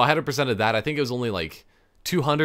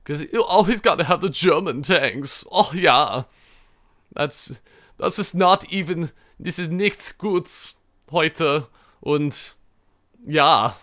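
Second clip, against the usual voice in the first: jokey put-on German accent, higher and slowed; mock German accent; 6 semitones higher, slower than usual. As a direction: play a hammy German tank commander accent. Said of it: "my German accent thing".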